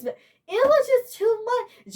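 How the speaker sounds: high-pitched real distress; emotional, upset. Real, not acted. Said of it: "I'm emotional and pissed".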